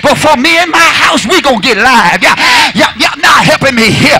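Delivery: high, loud shouting exhortation; whooping preacher. Acted. Pastor urging the church to praise, loud whoop. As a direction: shout it up to close.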